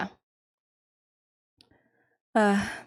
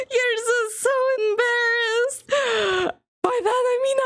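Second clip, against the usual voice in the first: much higher, playful tease; teasing high voice; 12 semitones higher than usual. Retold or imitated. Streamer teasing a flustered character, high and playful. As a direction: tease her in a squeaky voice.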